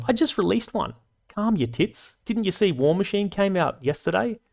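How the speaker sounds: high, teasing calm-down; mock exasperation. Real, not acted. Teasing viewers asking for videos.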